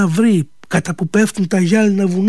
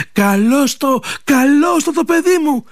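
higher and higher, calling out in delight; a mother greeting her son with joy; joyful cry; 8 semitones higher than usual. Acted. Mother welcoming her returning son, joyful rising call. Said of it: "φώναξε αυτή από τη χαρά της".

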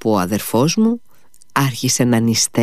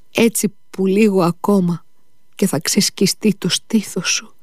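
high, breaking mother's lament fading to whisper; grieving mother; 6 semitones higher than usual. Acted. Mother laments over her feverish son, high and whispering. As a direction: voice her grief, ending on a whisper.

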